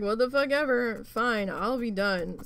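loud, fed-up outburst slowing at the end; exasperated surrender. Retold or imitated. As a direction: throw in the towel angrily. Retold exasperated giving up.

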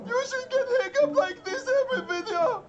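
laughing. Acted. Laughing at friend's hiccups.

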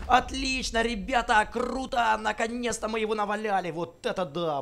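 loud, high, thrilled cheering; excited cheering. Real, not acted. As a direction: cheer on the final hit. Cheering on a winning fight.

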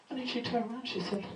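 ordinary talk

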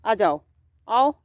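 ordinary talk, nothing marked

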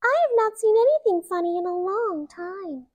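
lightly high mouse voice; a hint of a squeaky mouse; field mouse. A narrator's reading. Field mouse gleefully teasing, lightly high. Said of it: "said a field mouse".

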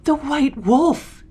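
slow, high, loud cry of shock; drawn-out high shout of discovery; astonished exclamation. A narrator's reading. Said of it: "exclaimed my father".

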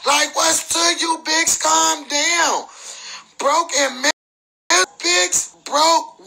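calling out a rival on camera, sharp and challenging; heated callout. Real, not acted.

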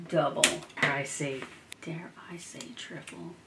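soft unvoiced whisper. Real, not acted. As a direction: hush it like a secret. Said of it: "whispering over the crafts".

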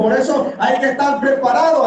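normal talking voice

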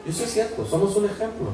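normal talking voice

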